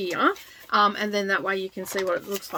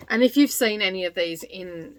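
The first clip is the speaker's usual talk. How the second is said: ordinary talk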